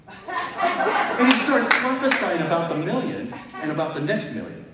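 high, loud and laughing through the words. Real, not acted. Preacher tells the congregation about the prophecy, laughing hard. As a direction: burst out laughing as you tell it.

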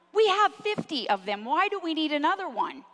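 wife scolding husband over flashlights, mock exasperated. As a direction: roll your eyes at yet another flashlight.

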